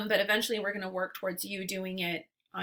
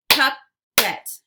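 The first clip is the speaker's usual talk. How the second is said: Puppet as loud "pup... pet"; split into syllables; 3 semitones higher, 9 dB louder than usual. Shown as a demonstration. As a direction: punch out both halves of Puppet separately. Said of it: "give you two parts to a word".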